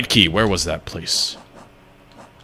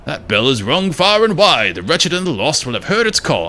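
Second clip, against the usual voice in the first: higher-pitched, acted game character; put-on character accent; 8 semitones higher than usual. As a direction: warn the stranger in a muddled put-on accent. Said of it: "what accent I'm doing for this guy".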